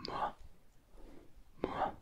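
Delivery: ma rising upward; ma lifting like a question; ma, rising second tone. Shown as a demonstration. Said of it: "c'est un ton qui monte".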